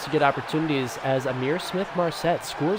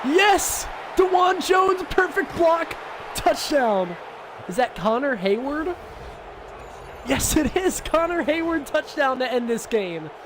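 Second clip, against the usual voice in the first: triumphant shouting; 11 semitones higher than usual. Real, not acted. Gamer celebrates the game-winning touchdown with shouts. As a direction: shout it like you just won the game.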